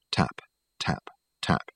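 breathy whispered tap. A narrator's reading. Narrator whispers Michael's tapping on the window. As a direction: whisper the taps softly.

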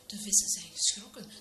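usual speaking voice

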